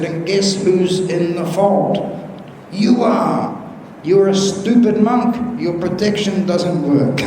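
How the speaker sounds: high mock accusing voice, slowed down; mock scolding. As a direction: play the indignant rider blaming the monk, half in jest. Monk voicing blame after an accident.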